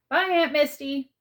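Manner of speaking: bright high call toward the kitchen. Acted. Boy calls goodbye to his aunt, loud and high. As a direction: sing out a quick goodbye.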